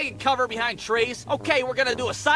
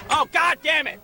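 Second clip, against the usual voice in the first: exasperated outburst; 4 semitones higher, 4 dB louder than usual. Acted. Kids annoyed by yowling cat.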